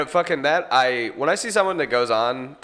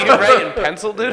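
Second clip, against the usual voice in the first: high, loud mock-annoyed question; half-laughing disbelief, playing up the annoyance; retold annoyance; 9 semitones higher, 7 dB louder than usual. Comic retelling confronting a friend about his pencil, mock annoyed. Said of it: "I got, like, so annoyed".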